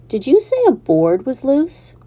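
a touch of excited pig; eager young pig. Acted. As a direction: pig checking the loose board. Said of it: "Wilbur asks".

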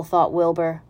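ordinary delivery

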